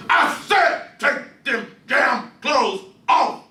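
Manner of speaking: slow, high, hammered command; a father forcing his child to strip; furious order. Acted. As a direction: spit out every word.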